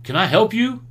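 retelling a mechanic dad snapping at a walk-in. Retold or imitated. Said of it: "snaps on him".

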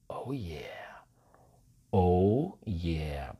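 low, hushed, slow gloating; very satisfied. Retold or imitated. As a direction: savor it softly, with deep satisfaction. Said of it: "Porque está muy satisfecha". (susurro bajo, muy satisfecho)

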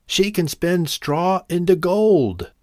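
high, slow called-out boast; storybook yell of a tall claim. A narrator's reading. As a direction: call it out high, stretching the words. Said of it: "yelled out a lie".

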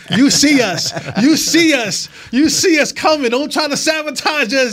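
high, loud playful call-out; mock warning. Mock warning to Derek about sabotage.

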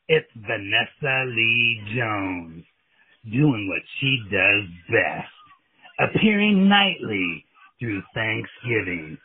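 hyped-up local commercial announcer; radio ad read. Acted. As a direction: sell it like a late-night radio spot. Mock strip club radio ad.

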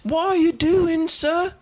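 high, hammed-up British accent; mock British grocer, voice pitched well up; British shopkeeper. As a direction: play a startled British clerk catching a thief. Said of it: "a British man that works at a grocery store".

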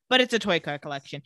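ordinary delivery

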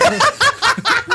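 high burst of laughter; loud laugh. Real, not acted. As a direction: laugh hard at your own joke. Laughing at own joke. (gargalhada alta)

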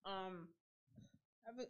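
coughing through the words; talking while choking on a cough. Real, not acted. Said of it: "Sorry, I'm choking over here".

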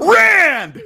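high, explosive yell of a name; loud shout. Real, not acted. Host hollers at his co-host, loud laughing shout.

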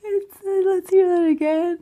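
very high, loud, incredulous; high-pitched, laughing astonishment; amused disbelief. Real, not acted. Amazed and amused at wrong guess.

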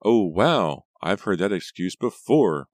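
loud, swooping mock amazement; sarcastic. A narrator's reading. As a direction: drip sarcasm on the excuse. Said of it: "Reva said sarcastically".

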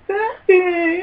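very high mock-crying child; taunted little kid. Littlest kid to taunting siblings, tearful mock wail.

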